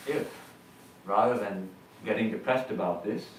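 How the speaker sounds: ordinary talk, nothing marked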